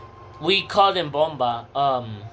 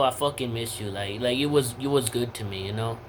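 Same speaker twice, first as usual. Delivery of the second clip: softer, heartfelt words to a missed friend; sincere to a friend; 6 semitones lower, 6 dB quieter than usual. Real, not acted. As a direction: say it softly to someone you miss. Speaking to absent friend Alexis.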